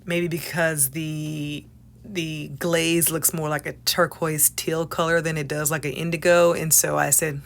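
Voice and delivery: ordinary talk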